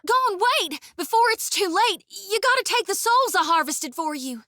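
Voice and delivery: high, urgent call after her god; begging before it's too late; frantic plea. Acted. Shodi calling after Gon to take her souls, high frantic plea. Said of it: "As she shouts".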